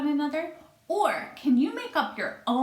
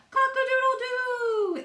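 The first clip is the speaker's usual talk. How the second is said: much higher crowing cock-a-doodle-doo; rooster crow; 5 dB louder than usual. Acted. Flustered fowl crowing, high cock-a-doodle-doo. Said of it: "who crowed".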